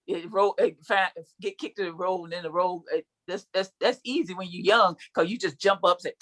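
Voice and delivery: ordinary delivery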